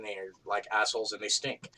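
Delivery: ordinary talk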